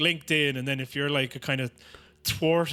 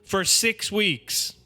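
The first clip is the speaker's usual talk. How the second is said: very slow, higher, deadpan punchline; Kamala Harris impression; 5 semitones higher, much slower than usual. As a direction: drag out the caveat with mock gravity. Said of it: "and she goes".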